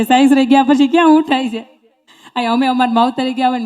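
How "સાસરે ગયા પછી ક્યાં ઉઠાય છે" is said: mock rhetorical jab. Mocking daughters-in-law's late rising.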